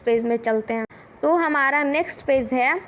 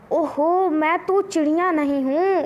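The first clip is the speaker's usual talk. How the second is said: ordinary delivery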